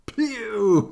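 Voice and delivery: loud, high pinched-nose pew; disgusted pew. A narrator's reading. Papa reacting to the skunk smell, loud and disgusted. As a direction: hold your nose and blurt it out. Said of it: "said Papa".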